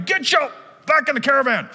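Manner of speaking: a hint of a shouting mother; mock yell. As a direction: act out the yelling she didn't do. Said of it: "She doesn't come in yelling".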